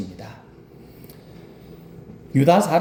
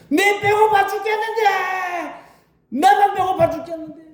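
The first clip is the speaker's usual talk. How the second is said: high, strained wail of hunger; desperate complaining yell. Shown as a demonstration. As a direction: cry out like a starving man at the end of his rope. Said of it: "이렇게 하고서 막 소리 지르면서". (배고파 울부짖는 높은 외침)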